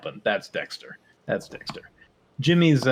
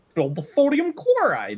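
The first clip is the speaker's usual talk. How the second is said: high nerdy correction; 13 semitones higher, 4 dB louder than usual. Retold or imitated. Jimmy Neutron meme quote.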